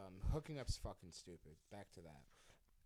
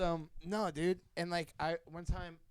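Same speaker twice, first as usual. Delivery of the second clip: normal talking voice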